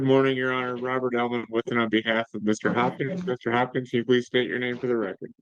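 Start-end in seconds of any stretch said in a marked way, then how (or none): none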